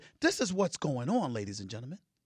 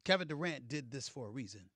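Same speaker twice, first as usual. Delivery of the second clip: normal talking voice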